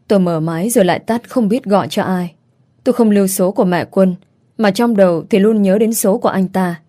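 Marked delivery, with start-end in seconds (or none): none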